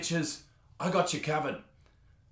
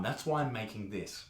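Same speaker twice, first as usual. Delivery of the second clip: normal talking voice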